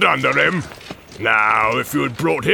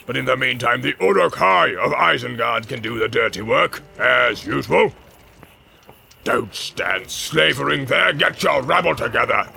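barking orc commands. Acted. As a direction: shout the troops onward. Ugluk commanding.

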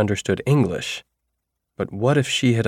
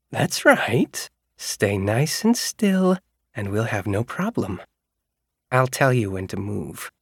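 light sing-song voice; 2 semitones higher than usual. Acted. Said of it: "light, sing-songy voice".

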